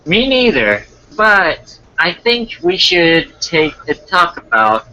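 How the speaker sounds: put-on girly date voice; girl's voice. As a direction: raise the pitch to play the girl. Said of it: "then I'll do the girl's voice".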